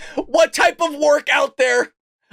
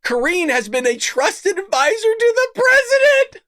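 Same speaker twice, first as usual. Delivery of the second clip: rising to a high, amazed pitch; news read on the edge of cracking up; incredulous reading; 8 semitones higher than usual. Real, not acted. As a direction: read it out climbing into disbelief.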